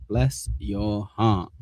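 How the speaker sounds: put-on slow, drawn-out Southern belle accent; a syrupy Southern put-down, stretched slow; mock Southern sweetness. Mocking chat with a slow, sugary Southern accent. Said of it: "Don't confuse Southern accent with friendly people".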